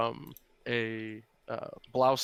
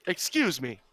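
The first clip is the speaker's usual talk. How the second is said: raised-pitch woman calling out; high-pitched woman's voice; 4 semitones higher, 4 dB louder than usual. Acted. Woman calling to strangers, in a high-pitched voice. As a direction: call out in a higher womanly voice. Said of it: "in a high-pitched woman's voice".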